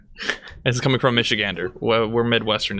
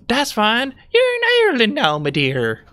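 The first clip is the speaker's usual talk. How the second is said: much higher, fast, breezy mom voice; mocking mom; 12 semitones higher than usual. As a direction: sing it out like a carefree tourist mom. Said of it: "and her mom goes".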